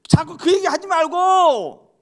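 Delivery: high, loud exasperated exhortation; full-force preaching. Acted. Preacher urges spouses to forget old mistakes, full-force preaching. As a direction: hammer the congregation to let it go.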